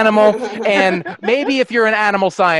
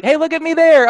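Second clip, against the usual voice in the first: high, bright mock ad pitch; paper's PR voice; 9 semitones higher than usual. Paper pitching itself, high mock promo voice. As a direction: sell it like a cheesy promo.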